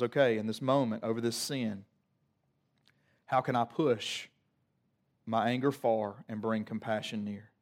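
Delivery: low, measured voice of God holding back anger; exhale, then speak calmly and low; collected after a breath. Acted. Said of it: "God literally catches his breath".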